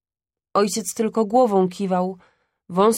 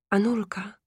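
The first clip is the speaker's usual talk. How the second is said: szept; 3 dB quieter than usual. Acted. Ojciec szepcze do chorej żony.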